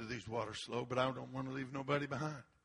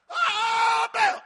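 high, loud cry to an idol; hammed-up agonized howl; mock desperate wail; 13 dB louder than usual. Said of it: "They're bleeding everywhere".

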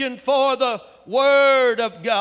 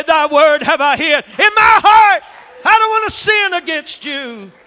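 loud, high, ringing declaration of the verse; shouted scripture; 4 semitones higher, 8 dB louder than usual. Acted. Preacher crying the verse to the congregation, loud and high. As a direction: cry the verse out at the peak.

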